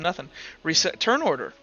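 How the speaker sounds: ordinary talk, nothing marked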